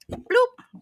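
high popping bloop; mimicked slurp sound; slurp noise. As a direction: imitate an oyster sliding down.

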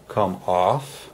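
normal talking voice

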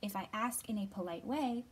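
expressive storybook reading voice; reading to children. Acted. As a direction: read it gently to little kids. Reads picture book to children.

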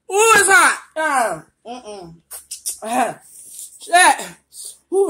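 yelping from the chili heat; mouth burning from spicy food. Real, not acted.

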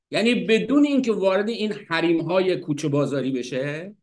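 normal talking voice

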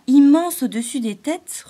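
high, loud looming phrase; looming menace. A narrator's reading. Lisa's thought swells to show the giant, loud and looming. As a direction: make it huge and menacing. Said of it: "en se grossissant".

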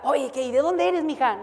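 a professor casually befriending the student; friendly professor. Retold or imitated. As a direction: play the professor as all smiles and small talk. Professor chatting kindly with student.

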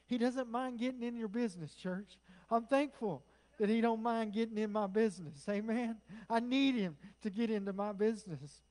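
loud, fervent preacher; full-force preaching. Real, not acted. Preacher thankful God gets in his business, full-force preaching.

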